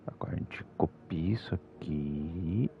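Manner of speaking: soft whisper so nobody wakes; hushed, breathy under the voice. Real, not acted. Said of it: "Hoje tô falando um pouco mais baixo".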